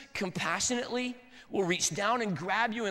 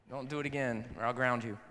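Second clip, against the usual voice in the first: lower, mock-stern dad warning; 7 semitones lower, 4 dB quieter than usual. Dad threatening his son after a scare, mock-stern. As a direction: play the strict father, half joking.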